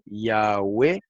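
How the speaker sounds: "Yahweh" leído despacio, sílaba a sílaba: ia-we; pronunciación demostrada. Shown as a demonstration. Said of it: "así como lo voy a leer". ("Yahweh" pronunciado ia-we, con calma)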